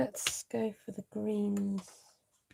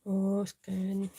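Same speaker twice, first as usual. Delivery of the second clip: ordinary delivery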